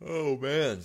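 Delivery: yawning through the words. Real, not acted. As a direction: say it mid-yawn. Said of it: "sorry for the yawn".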